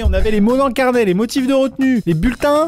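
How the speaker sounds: high, indignant outrage at the new form; outraged disbelief. Acted. Reading a homework slip, high and outraged. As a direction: squeal it out, scandalised.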